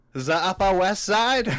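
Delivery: put-on high, slow Eastern European accent. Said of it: "thick, uh, Eastern European accent".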